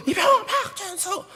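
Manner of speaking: very high, squealed military reply; recruit's roll-call answer. Retold or imitated. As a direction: yelp out rank and name. Recruit answers when called by senior.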